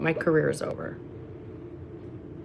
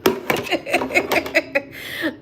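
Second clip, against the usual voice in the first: helpless giggling laugh; real laughter; 5 semitones higher than usual. Real, not acted. Streamer cracking up after singing, real laughter. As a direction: crack up at yourself.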